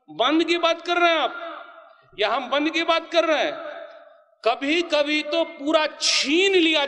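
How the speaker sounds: preaching shout. Real, not acted. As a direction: shout the question at the crowd. Preacher rebukes the crowd.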